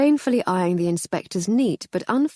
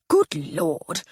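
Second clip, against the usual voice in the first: blustering army captain, flustered; Captain Summers rambling about the band; captain's toff chatter; 3 dB louder than usual. Acted.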